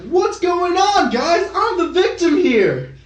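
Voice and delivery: mock him playing the poor victim, high and slow; whiny victim. Mocking Logan's victim video, high whiny voice. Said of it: "it's like".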